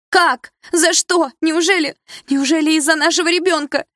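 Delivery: very high loud anguished cries; hysterical mother. A narrator's reading. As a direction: wail it in hurt disbelief. (мать в истерике кричит)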